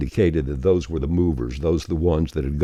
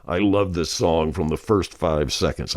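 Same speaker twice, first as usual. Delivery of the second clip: ordinary talk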